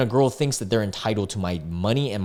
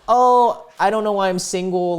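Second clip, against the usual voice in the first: higher-pitched whiny imitation of complainers; mock complaining; 8 semitones higher than usual. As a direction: put on an exaggerated woe-is-me lament. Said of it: "and then just complain".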